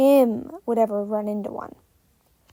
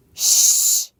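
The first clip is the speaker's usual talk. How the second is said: a sharp, urgent hiss to hush him; whispered shh, nearly as loud as a scream; hissed shush; 4 dB louder than usual. A narrator's reading. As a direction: hush the screaming boy with one hard hiss. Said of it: "someone hissed".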